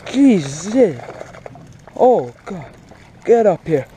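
ordinary delivery

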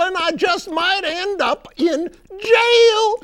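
very high, loud frog reciting verse; drawn-out, high-pitched poetic showing off; Beauregard the Frog. Acted. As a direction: declaim a wedding poem as a cartoon frog. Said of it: "I wrote a poem special".

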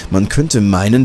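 ordinary delivery

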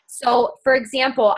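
ordinary delivery

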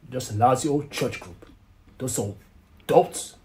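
loud, exasperated dismissal; worked-up, contemptuous griping; scornful outburst. Acted. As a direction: rant scornfully, punching the insults. Scornful rant about church group.

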